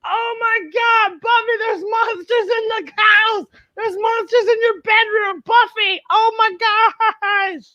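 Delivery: high, shrieking, hammed-up alarm; panicked mom. Mom finding monsters in Buffy's bedroom, high panicked shriek. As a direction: shriek like a shocked mother. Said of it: "Mom goes".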